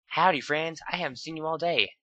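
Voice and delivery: lightly voiced Applejack; Applejack's voice. Applejack greets friends.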